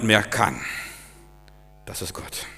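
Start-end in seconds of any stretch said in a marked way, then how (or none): 1.9-2.5 whispered, much softer; hushed. Real, not acted. Preacher to the congregation, a whispered reveal. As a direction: breathe it out like a secret. Hushed climax of sermon point.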